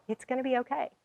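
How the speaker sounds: hushed, telling herself it will be fine; soft self-reassurance. Retold or imitated. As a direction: murmur it to calm yourself down. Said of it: "That's what I kept telling myself".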